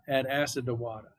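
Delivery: WATER as "wah-tah" in a Boston accent; put-on Boston accent for a memory rhyme. Shown as a demonstration. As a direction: drop the final R in water, Boston style. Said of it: "try to do my best Boston accent".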